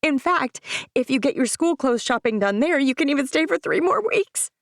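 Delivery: nervous mom talking too fast; auctioneer rush. Acted. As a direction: rattle it off like an auctioneer. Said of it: "I'll sound like an auctioneer".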